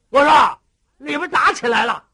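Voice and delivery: loud alarmed shout. Acted. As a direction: yell to the brothers that they're fighting. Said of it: "当时有这个齐彪一喊".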